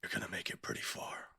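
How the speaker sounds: soft unvoiced threat; whispered menace. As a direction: breathe it out coldly. Father's cold praise after killing.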